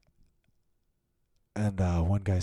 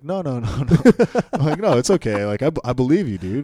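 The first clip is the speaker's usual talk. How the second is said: high, loud, panicky pleading; nervous protest; 6 semitones higher, 9 dB louder than usual. Retold or imitated. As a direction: back off fast, scared of him.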